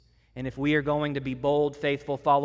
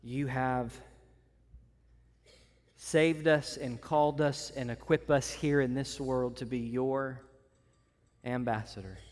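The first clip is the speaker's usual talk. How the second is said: prayer. Acted. Leads closing prayer.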